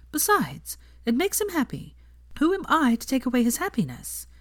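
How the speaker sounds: a hint of a young woman's voice; Hermione voice.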